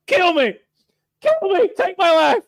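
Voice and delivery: very high, loud mock begging; hammed-up desperate plea; pleading lady. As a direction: beg dramatically in a lady's voice. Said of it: "and she's like".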